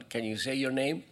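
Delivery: usual speaking voice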